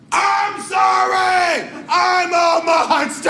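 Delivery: high, loud, slow wailing; shouting an apology while fleeing; mock anguished cry. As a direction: wail it like a guilty monster. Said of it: "I'll tell you how it felt".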